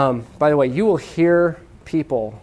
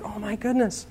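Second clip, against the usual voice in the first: hushed, higher-pitched alarm; freaking out; 5 semitones higher, 10 dB quieter than usual. Acted. Crowd realizing whom they killed, freaking out quietly. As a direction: gasp it softly in mock panic. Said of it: "they're freaking out".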